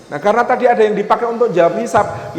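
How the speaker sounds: ordinary talk, nothing marked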